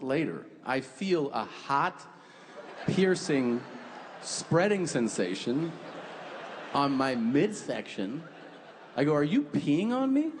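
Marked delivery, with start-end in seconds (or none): 0.8-8.2 slow, drawn-out, savoring each word; dramatic slow buildup. Acted. Comic telling the crowd about the warm pee, slow drama. 9.1-10.3 shocked realization. Retold or imitated. Dad realizing his son is peeing on him, alarmed shock.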